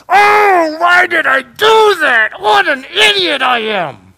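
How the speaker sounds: preacher acting out the weeping and gnashing of teeth with a loud, slow wail; anguished regret. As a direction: wail it like you're kicking yourself. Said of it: "You know what weeping and gnashing of teeth is?".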